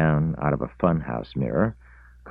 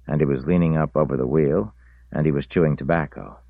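usual speaking voice

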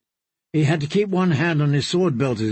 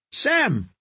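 calling out; 8 semitones higher than usual. A narrator's reading. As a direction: call his name across the room, higher. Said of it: "Darian's familiar voice rang out".

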